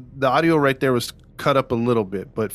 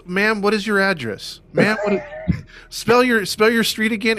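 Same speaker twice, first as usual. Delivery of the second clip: mock dispatcher; 8 semitones higher than usual. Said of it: "mock dispatcher".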